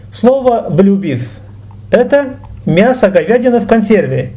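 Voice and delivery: usual speaking voice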